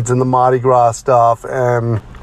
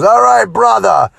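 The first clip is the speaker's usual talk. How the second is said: Jimmy Snuka impression; 10 semitones higher than usual. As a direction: ham up the fired-up veteran, pitched high. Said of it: "he looks at me and goes".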